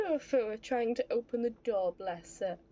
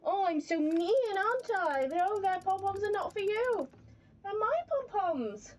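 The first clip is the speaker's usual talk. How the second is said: doting pet talk; 6 semitones higher than usual. Real, not acted. Still fussing over the pet.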